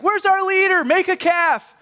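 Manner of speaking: mocking the people demanding an idol; whiny Israelites. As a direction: squeal it like impatient complainers. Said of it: "they're like".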